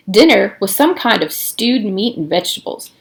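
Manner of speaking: ordinary delivery, nothing marked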